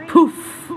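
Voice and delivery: a playful poof as the worry vanishes; magic poof. Acted. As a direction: blow the worry away.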